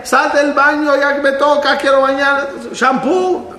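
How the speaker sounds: high, hurried call to the bathroom; hurried family member. Acted. A relative rushing someone out of the bath before Shabbat, high and hurried. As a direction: hurry whoever is in the bath.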